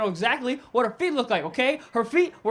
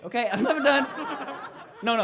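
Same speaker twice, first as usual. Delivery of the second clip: usual speaking voice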